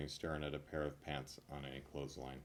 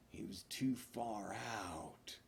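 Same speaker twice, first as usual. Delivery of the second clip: whisper; 3 semitones higher, 7 dB quieter, slower than usual. Pretend. Punchline whispered in a put-on voice.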